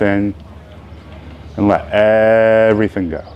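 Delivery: unhurried phrases with long, spacious pauses; guided meditation. Real, not acted. Teacher leading the opening meditation of a beginners' yoga class, unhurried. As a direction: guide them into ease, leaving room to breathe.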